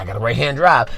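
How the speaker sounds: low, soft mock admission; sheepish. Worker explaining to the coworkers, meekly subdued. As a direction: confess it small and low.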